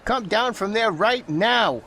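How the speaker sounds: high put-on scolding holler; the trainmaster scolding the monkey; high stern holler. A narrator's reading. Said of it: "he hollered at George".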